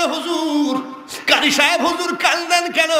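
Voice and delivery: high, urgent call to the master; acted questioning call. Pilgrims call out in story. (উঁচু গলায় ডেকে জিজ্ঞাসা)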